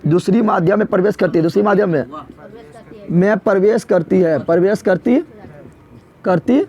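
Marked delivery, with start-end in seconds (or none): 3.1-6.6 drawn out, one word at a time; slow dictation. Shown as a demonstration. Teacher dictating the definition of refraction, slowly. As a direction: pace it for pupils taking notes. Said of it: "तो क्या बोलेगा?".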